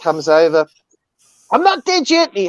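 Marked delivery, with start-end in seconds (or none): 1.5-2.3 much higher, a little mouse talking back; squeaky mouse voice. Retold or imitated. The mouse answers the snake in a tiny, much higher voice. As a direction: pipe it up like a tiny cheeky mouse.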